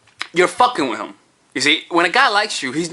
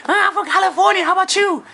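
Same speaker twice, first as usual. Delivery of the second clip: much higher, chirpy answer; eager girl. Acted. Woman answering the guy's question, high and eager.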